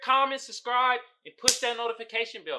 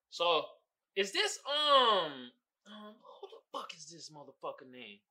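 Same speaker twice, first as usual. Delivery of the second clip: ordinary talk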